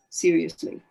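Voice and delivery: ordinary delivery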